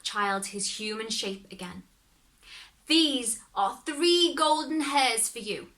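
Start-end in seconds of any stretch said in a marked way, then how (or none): 2.9-5.7 slow, slightly higher old woman's voice, lightly voiced; grandmother handing over. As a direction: gives the hairs with slow care. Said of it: "said she".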